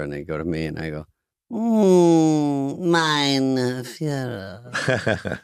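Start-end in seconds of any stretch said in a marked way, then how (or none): 1.5-5.4 higher, very slow mock German accent. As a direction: drag out mein Führer in a hammy German accent. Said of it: "Mike Myers was Hitler".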